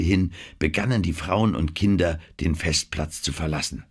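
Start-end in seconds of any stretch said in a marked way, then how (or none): none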